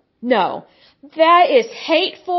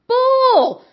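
much higher, building on the first cry; louder scornful outburst; 10 semitones higher than usual. Real, not acted. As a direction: push it even higher in disgust.

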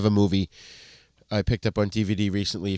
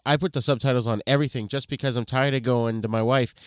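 ordinary talk, nothing marked

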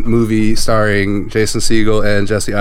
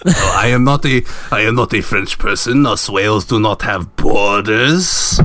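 put-on foreign whale caller; [name]. Whale caller denying he is French, put-on accent. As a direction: protest in a mock French accent, slowing at the end.

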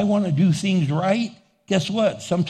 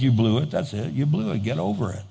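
God speaking; 5 semitones lower, 4 dB quieter, faster than usual. Retold or imitated. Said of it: "And God says".

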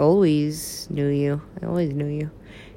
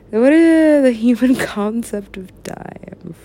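slow, loud grand musing with a laugh; mock cosmic voice; 6 semitones higher, 5 dB louder, slower than usual. Pretending to be God shrugging off time, slow and loud. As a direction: play a lofty god.

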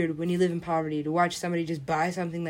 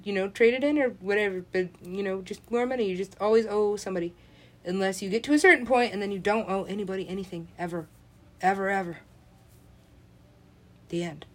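ordinary talk, nothing marked

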